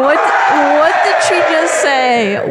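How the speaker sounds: slow, laughing half-shout; laughing disbelief. Real, not acted. Prank caller cracking up after the call, laughing.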